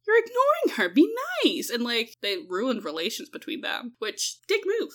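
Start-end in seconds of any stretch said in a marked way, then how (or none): 0.0-1.7 scolding parents. Retold or imitated. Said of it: "were like".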